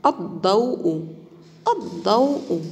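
الضوء with a heavy, emphatic ض; emphatic ض demo. Shown as a demonstration. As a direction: model الضوء twice, stressing the ض. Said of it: "سوف نقوم بقراءتها مرة أخرى". (نطق الضاد المفخمة في الضوء)

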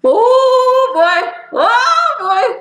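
high, bouncy delight; excited sing-song. Real, not acted. Fan cheering the show, excited sing-song. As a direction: squeal it out like a thrilled fan. Fan thrilled watching anime.

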